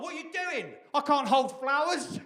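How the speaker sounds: high, whiny complaining vase voice; high-pitched grumbling pot; talking vase. Retold or imitated. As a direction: play a fussy vase moaning at its potter. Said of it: "saying to the potter".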